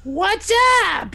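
very high witch screech; high-pitched cartoon hag; screaming witch. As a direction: answer in a piercing witchy shriek. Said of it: "she, uh, she looks like this".

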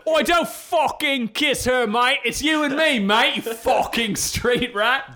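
high, loud cockney lout yelling; shouty cockney Abu. Pretend. Imagining Vinny Jones as Abu in a loud cockney voice. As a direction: bark it like a pub thug.

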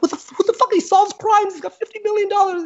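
high, incredulous squeal; stunned disbelief. Retold or imitated. Teller learning the monk is rich, high incredulous squeal. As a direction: sound floored by it all. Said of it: "And I was just like".

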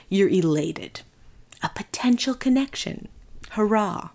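measured, deliberate sponsor read with pauses; podcast ad read. Acted. Host reads dating app ad.

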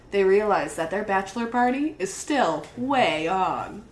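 ordinary delivery

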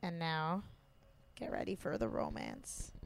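low, soft, nearly whispered announcement; hushed teaser. Pretend. Introducing the romance to a co-host, hushed. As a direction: drop to a hush to tease it. Teasing the coming romantic scene.

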